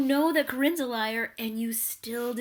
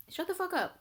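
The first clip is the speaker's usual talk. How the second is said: higher, pushing up on the; exasperated; 9 semitones higher than usual. Acted. Vlogger telling Nick off again, pitched up and exasperated. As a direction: throw it at him, fed up.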